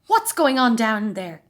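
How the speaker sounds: loud, slow call down the stairs; a dad shouting loud to the boys below. A narrator's reading. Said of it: "shouted Dad".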